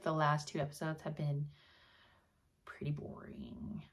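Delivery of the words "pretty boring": lower, soft, slow whisper; whispered verdict. Real, not acted. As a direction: let it sag into a bored whisper. Judging boring episodes.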